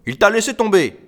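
high, loud jab; loud, high-pitched taunt; irritated retort. Acted. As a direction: throw it at them crossly. Man taunts quarrelling woman.

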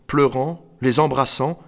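ordinary delivery, nothing marked

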